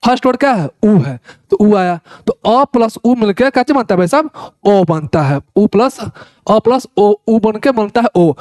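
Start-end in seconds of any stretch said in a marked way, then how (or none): none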